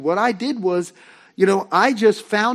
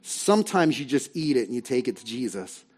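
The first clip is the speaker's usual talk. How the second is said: ordinary talk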